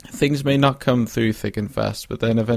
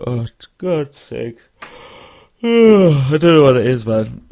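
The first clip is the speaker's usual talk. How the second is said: exasperated sigh; 3 semitones higher, 7 dB louder, slower than usual. Real, not acted. Frustrated sigh about weight.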